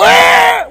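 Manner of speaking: sudden high mock baby scream; baby's scream. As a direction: yelp like a baby who lost his rattle. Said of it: "he would go".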